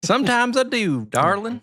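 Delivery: high-pitched playful southern drawl. Pretend. Host jokingly answers whether they have a drawl, put-on high southern drawl. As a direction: put on a sweet southern "darling" lilt. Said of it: "Do you have a southern drawl?".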